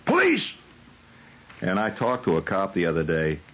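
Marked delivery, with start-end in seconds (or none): 0.1-0.5 very high mock holler; short, high-pitched police holler; hollering TV cop. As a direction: squeal it out like an overexcited cop. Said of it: "he's always hollering".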